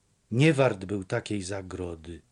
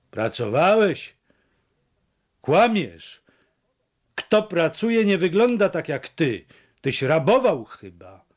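higher, louder contemptuous scolding; angry contempt; 5 semitones higher, 4 dB louder than usual. A narrator's reading. Mother accuses her son of theft, angry contempt. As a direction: throw his lie back at him. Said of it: "poczerwieniała od gniewu i wstrętu".

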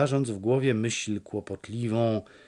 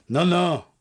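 higher, louder surprised no, no; marvelling; 7 semitones higher, 6 dB louder than usual. A narrator's reading. Fisherman marvels at the returned Paweł, surprised. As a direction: let the fisherman's wonder burst out. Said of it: "dziwił się rybak".

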